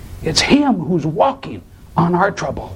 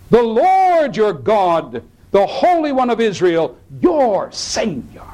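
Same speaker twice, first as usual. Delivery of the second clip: slow, raised solemn reading voice; scripture reading; 7 semitones higher than usual. Acted. Pastor reads Isaiah to congregation, solemn and slow. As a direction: proclaim God's words slowly and reverently.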